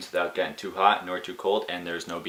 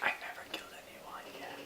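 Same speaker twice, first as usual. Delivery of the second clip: much softer, unvoiced joke whisper; joking whisper; 15 dB quieter than usual. Jokes in whisper.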